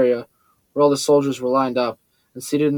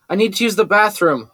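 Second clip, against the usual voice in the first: calling through the door, raised; 7 semitones higher than usual. A narrator's reading. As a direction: call out for help. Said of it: "I called out".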